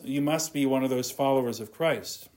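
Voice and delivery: usual speaking voice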